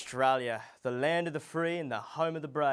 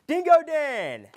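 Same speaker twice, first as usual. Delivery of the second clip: much higher, louder and slow; grand welcome call; big guest introduction; 13 semitones higher, 11 dB louder, slower than usual. Acted. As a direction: stretch the name out like a game-show host.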